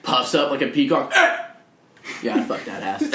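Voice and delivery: usual speaking voice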